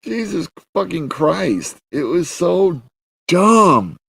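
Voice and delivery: slow, high-pitched exasperation; exasperated. Real, not acted. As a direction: despair at the stupidity. Exasperated verdict on the show.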